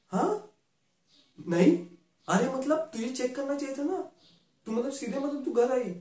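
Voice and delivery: high, exasperated telling-off; you should have checked!; frustrated friend lecturing; exasperated scolding. Acted.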